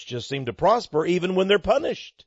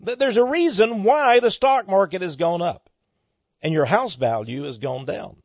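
ordinary talk

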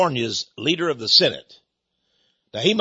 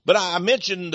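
ordinary delivery

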